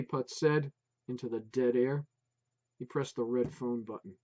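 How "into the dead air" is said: ordinary delivery, nothing marked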